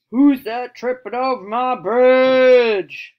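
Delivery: slow, very loud troll roar. Acted. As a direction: bellow it slowly as an angry troll, drawing out the end. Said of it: "Roared the troll".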